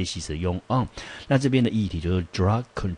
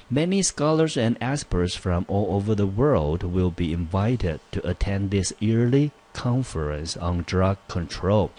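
careful, measured English read-aloud; reading exam sentence aloud; 2 semitones higher, slower than usual. Shown as a demonstration. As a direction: read the test sentence out clearly for students. Reads the question aloud. (清楚地朗读英文题目)

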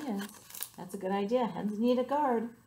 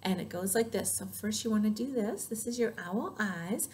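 usual speaking voice

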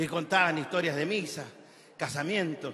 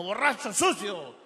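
slow, raised acted insult; louder, drawn-out angry jab; angry taxi driver; 4 semitones higher, 5 dB louder, slower than usual. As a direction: spit it out as an annoyed cabbie. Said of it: "El taxista enojado".

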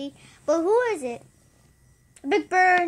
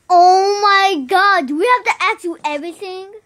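exasperated; 4 semitones higher, 9 dB louder than usual. Acted. Exasperated at not knowing stairs.

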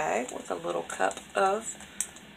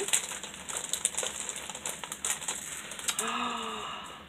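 sharp breathy gasp of delight; 5 semitones higher, 9 dB quieter than usual. Real, not acted. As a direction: gasp at the surprise inside. Delighted gasp opening a gift.

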